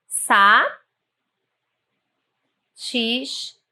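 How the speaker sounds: "sa", "tis" said slowly, apart; syllable split. Shown as a demonstration. Teacher splits "satisfeito" for students, slowly and syllable by syllable. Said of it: "na palavra satisfeito".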